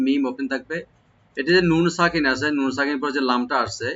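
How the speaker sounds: ordinary delivery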